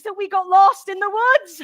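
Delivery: high, loud reveal; dismayed outburst. Acted. Narrator revealing they got lost, loud dismay. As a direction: build to a dismayed cry.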